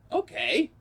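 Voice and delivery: high, startled okay; surprised okay. Retold or imitated. Coworker suddenly kisses him, he reacts surprised. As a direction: caught off guard, pitch jumps up on okay.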